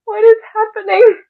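high, rising-panic question; loud, high-pitched disbelief; alarmed. Acted. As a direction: cry out in bewildered alarm. Panicked reaction to the cult.

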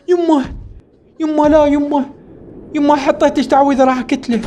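high, urgent cries for mom; yelling at the screen as she's taken; panicked calls. Real, not acted. Player yelling at mother's capture, panicked.

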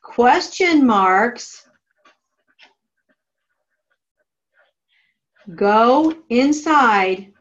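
slow, word-by-word dictation; dictating. Shown as a demonstration. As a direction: spell it out slowly for note-taking. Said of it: "Draw an arrow to your margin that says".

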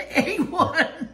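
laughing through the words. Real, not acted. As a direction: giggle out the confession. Granddaughter ate a slice.

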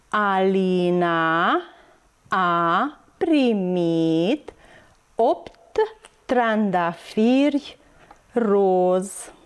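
slow, word by word with long pauses; very slow model reading for children learning to read; beginner's reading demonstration. Shown as a demonstration. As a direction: read syllable by syllable, drawing out each vowel. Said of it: "Haideți să citim".

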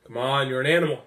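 usual speaking voice

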